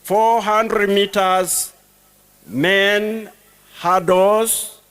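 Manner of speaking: loud, ringing roll call; stadium announcer. Real, not acted. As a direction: call names across a stadium.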